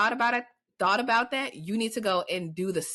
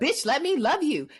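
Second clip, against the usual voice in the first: higher, pushy mock demand; bossy loving demand; 8 semitones higher than usual. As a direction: insist on loving her, playfully. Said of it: "what I'm catching".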